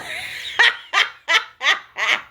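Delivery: high-pitched mocking laugh; laughing off an angry person; high amused cackle. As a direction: cackle at their tantrum. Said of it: "I'ma laugh".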